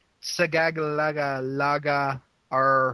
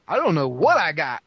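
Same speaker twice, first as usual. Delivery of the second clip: louder mock holler with swooping pitch; hammed-up catchphrase; 3 semitones higher, 5 dB louder than usual. Host echoing teasing co-hosts, hammed-up mock holler. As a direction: punch it out playfully, pitch leaping up. Joking echo of the teased catchphrase.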